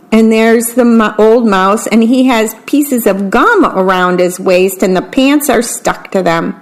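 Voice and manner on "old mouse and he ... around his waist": ordinary delivery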